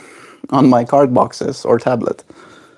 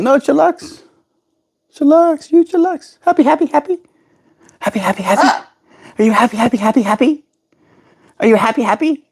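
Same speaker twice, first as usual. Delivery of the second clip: high parrot voice; 14 semitones higher, faster than usual. Pretend. Voicing the parrot, high pitched.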